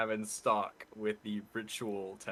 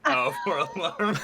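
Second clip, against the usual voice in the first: high, loud amused laugh; 11 semitones higher, 8 dB louder than usual. Real, not acted. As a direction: laugh through the words. Laughs at pun.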